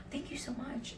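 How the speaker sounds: high, soft, flattered thanks; gushing hostess. Retold or imitated. Hostess thanking a customer for praising her outfit, softly gushing. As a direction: imitate a touched hostess gushing quietly. Hostess acted, thrilled by the compliment.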